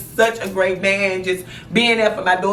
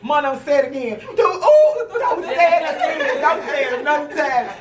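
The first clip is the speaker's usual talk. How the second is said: higher, wailing mock protest; mad daughter; 5 semitones higher than usual. Daughter in labor begging mother to stop, mock-mad wail. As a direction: plead angrily, imitating a woman in labor. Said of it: "Boy, she was so mad".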